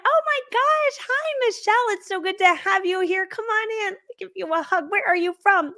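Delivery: high, slow, sugary greeting; gushing sweet ladies. Retold or imitated. Welcoming ladies hugging the newcomer, high sugary gush. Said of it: "They're like".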